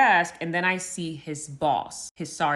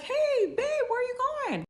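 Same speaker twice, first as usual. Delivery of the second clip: mock-sweet husband, pitched way up; husband's voice. Husband calling sweetly to his wife, high and carefree. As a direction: play the clueless husband calling out brightly. Husband casually greets her after cheating.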